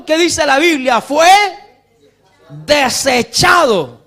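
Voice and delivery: slow, loud, high preacher reading the verse; preaching shout. Acted. Pastor shouting the verse at his congregation, slow and loud. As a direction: thunder the scripture at the congregation.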